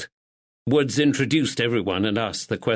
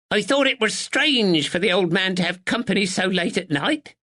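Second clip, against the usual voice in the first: put-on sharp old woman; 9 semitones higher than usual. A narrator's reading. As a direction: snap it out like a sharp sparrow.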